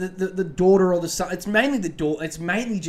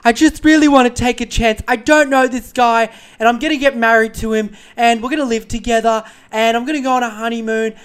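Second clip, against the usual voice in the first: high, loud mock carefree gush; high hammed-up bride-to-be; airy daughter voice; 8 semitones higher, 12 dB louder than usual. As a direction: gush like a naive thirty-something. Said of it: "She's like".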